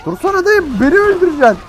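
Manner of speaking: high loud pleading yell; alarmed yelling. Real, not acted. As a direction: yell at driver to stop. Yelling at driver to stop.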